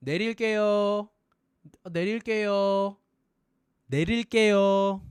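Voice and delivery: 내릴게요 fast, loud and high; natural Korean. Shown as a demonstration. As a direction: rattle off 내릴게요 quickly.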